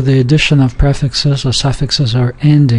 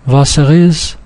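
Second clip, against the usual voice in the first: "Was er is" linked as one string; words run together; 2 semitones higher than usual. Shown as a demonstration. Teacher reading the example aloud, words run together. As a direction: glide through "Was er is" without pausing before the vowels. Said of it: "so you don't make a pause".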